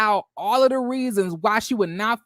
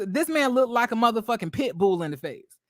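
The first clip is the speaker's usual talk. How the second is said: ordinary talk, nothing marked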